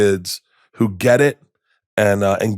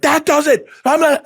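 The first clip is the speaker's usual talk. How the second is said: mock angry dad threat; 4 dB louder than usual. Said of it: "You can't just go like".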